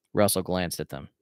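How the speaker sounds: usual speaking voice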